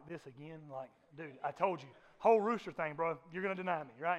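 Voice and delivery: a tired, offhand "dude, I told you"; weary Jesus. Retold or imitated. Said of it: "And Jesus is like".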